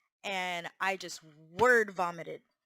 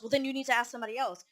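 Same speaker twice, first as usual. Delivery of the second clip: quick, higher, louder retort; retold hostility; 4 semitones higher, 5 dB louder, much faster than usual. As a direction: imitate a boss giving a curt order.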